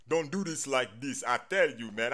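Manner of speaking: ordinary talk, nothing marked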